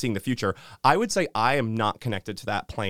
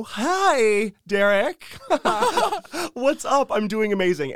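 overly cheery greeting; 12 semitones higher, slower than usual. Retold or imitated. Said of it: "I look them right in the eye and I go".

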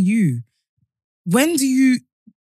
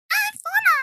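high squeaky chipmunk voice; 4 dB quieter, much slower than usual. Pretend. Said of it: "How do they speak?".

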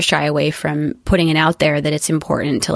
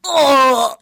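high, drawn-out pained "oh"; hurt-yourself groans; 8 semitones higher, 3 dB louder than usual. Shown as a demonstration. Guest shows the director her game injury sounds, acted pained groans. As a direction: groan as if badly hurt in a fall. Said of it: "like you really hurt yourself".

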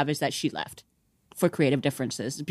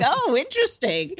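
high-pitched mock-intrigued "oh"; intrigued reaction; 9 semitones higher than usual. Guest recalling learning it was Casper, intrigued and high. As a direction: retell a piqued little discovery, pitched up high.

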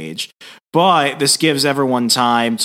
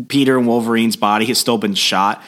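ordinary delivery

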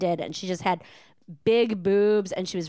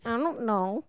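put-on baby talk whimper; 2 semitones higher, 4 dB quieter than usual. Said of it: "That's my favorite".

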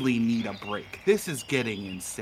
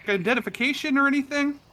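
high suspicious guest voice; 13 semitones higher, faster than usual. Acted. NPC voice, high fast.

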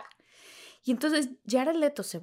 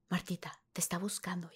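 whispered; 4 semitones lower, 12 dB quieter than usual. Retold or imitated. Sister whispering backstage.

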